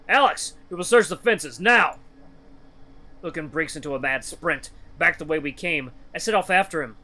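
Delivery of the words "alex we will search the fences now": loud, urgent order; urgent command. Acted. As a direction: bark the order.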